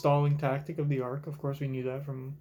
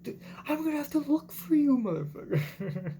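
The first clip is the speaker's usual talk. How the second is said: high, whiny near-sob, much higher; mock crying Sanji; 11 semitones higher than usual. Mimicking Sanji whining about searching for Zoro, near tears. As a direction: wail it like he's about to cry. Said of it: "Sanji's almost crying".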